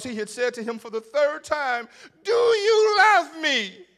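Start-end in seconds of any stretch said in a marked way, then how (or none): 2.2-3.9 loud, high, slow crying plea; pleading cry. Acted. Jesus asks Peter third time.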